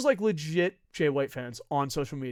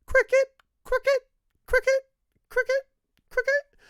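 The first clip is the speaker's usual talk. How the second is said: much higher, squeaky cricket chirping; mock cricket chirps; 7 dB louder than usual. Mocking silence after debate offer.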